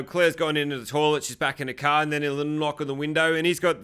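normal talking voice

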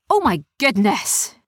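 put-on gasped-out astonishment from a pirate girl; astonished pirate girl. A narrator's reading. Dark figure recognising tied-up friends, astonished. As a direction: exclaim in shocked recognition.

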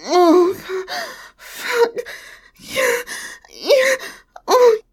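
breathless escalating moans; moaning, building. Acted. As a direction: let the moans build and break. Building toward climax, moaning.

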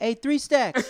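high excited street call. Retold or imitated. Fan hailing Andre on the street, excited call. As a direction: shout across to a celebrity.

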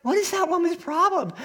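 high-pitched imitated indignation; indignant bafflement. Woman in the car about the yeller, baffled indignation.

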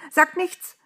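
high, loud urgent exclamation; excited high-pitched call. A narrator's reading. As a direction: cry it out to stop someone. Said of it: "rief sie dem Engel entgegen".

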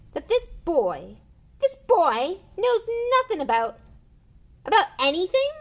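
very high, loud outburst; outraged. Acted. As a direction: explode at them. Hagrid raging at the Dursleys.

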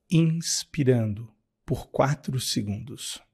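soft, low guided breathing cues; calmly pace the listener's breaths. Acted. Guides breathing meditation over music.

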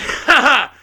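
loud, high taunting ha ha; mocking the cop with a loud laugh. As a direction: laugh it in the cop's face. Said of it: "And I just laugh".

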